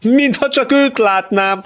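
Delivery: very high, drawn-out cry; shouting. Acted. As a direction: exclaim it slowly in a high voice. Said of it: "kiáltotta valaki". (elnyújtott, magas hangú kiáltás)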